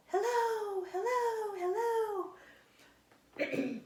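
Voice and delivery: high, echoing call into the woods; calling hello. Retold or imitated. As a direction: call out and let it echo away. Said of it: "where you go".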